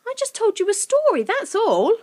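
put-on high, loud girl's voice; indignant, pleading innocence; protesting. A narrator's reading. Girl insists she only told stories, protesting. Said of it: "protested Lucy".

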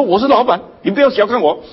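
high, pompous boss voice; lightly voiced pompous boss; boss bragging. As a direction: puff up like a self-important boss. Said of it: "他都在表示说".

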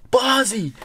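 high excited call of his name; a kid spotting his hero, calling out happily; excited shout of recognition. Retold or imitated. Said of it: "He's like, he's like".

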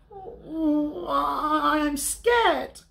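high, drawn-out frightened cub wail; Little Bear. A narrator's reading. Little Bear cuddles up to Big Bear outside, high frightened cub voice. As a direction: quaver it out high like a scared cub.

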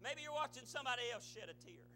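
ordinary talk, nothing marked

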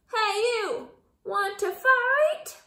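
high, loud, slow dare; bellowed challenge; loud shout. A narrator's reading. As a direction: shout up at the giant. Shouting at the whale.

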